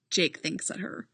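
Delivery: ordinary delivery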